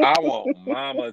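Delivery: high, loud, playful protest; excited high retort. Real, not acted. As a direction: exclaim it eagerly, voice jumping up.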